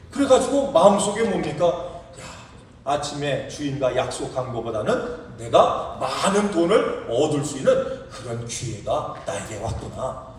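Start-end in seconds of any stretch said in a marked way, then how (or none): none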